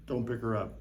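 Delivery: usual speaking voice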